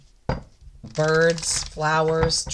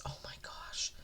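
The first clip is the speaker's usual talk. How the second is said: overwhelmed, barely audible whisper; real awestruck whisper; moved whisper; 18 dB quieter than usual. Real, not acted. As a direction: whisper it, moved almost to tears. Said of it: "I'm actually getting teary-eyed right now".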